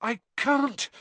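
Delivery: high, strained gasp; panting. A narrator's reading. Harry struggling not to sink, panting. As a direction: say it struggling for breath. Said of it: "Harry panted".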